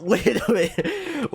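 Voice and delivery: can't believe it, laughing; laughing disbelief. Real, not acted. Player laughing at the dramatic twist, laughing in disbelief.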